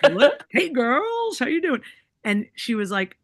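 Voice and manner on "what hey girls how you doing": high, loud jovial hello in a put-on voice; playful imitation, pitched way up and louder; mimicking the uncle. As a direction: greet the girls like a boisterous uncle. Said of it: "mimicking my uncle".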